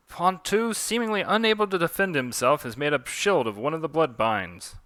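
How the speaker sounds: play-by-play call starting high; animated sportscaster voice; match announcer. Acted. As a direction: narrate the fight like a ringside commentator. Calls the duel as commentator.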